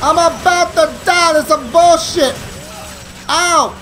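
high-pitched frantic complaint; game panic. Pretend. Gamer about to die under fire, high panicked cry.

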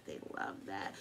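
soft voiceless whisper; whispered aside. Real, not acted. Reader whispering that the person loves it. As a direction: murmur it under your breath.

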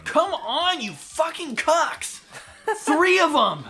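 high, loud exasperated outburst; frustrated yelling. Real, not acted. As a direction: yell at the game in frustration. Raging at the game.

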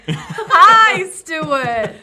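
big, high, laughing hello; loud greeting. Acted. Shopkeeper greeting the friend who burst in, with a loud laughing hello. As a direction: belt out a hello through a laugh.

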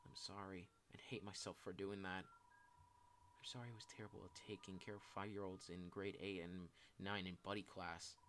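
subdued, self-blaming apology; dejected confession. Acted. Shy person apologizing for past awkward moments, dejected confession. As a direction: confess old regrets in a low-key, downcast voice.

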